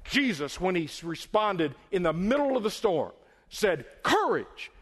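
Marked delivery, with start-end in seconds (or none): 4.0-4.7 high, sharp one-word cry; raised preacher's command. Acted. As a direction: ring out COURAGE over the storm. Jesus responding in the storm.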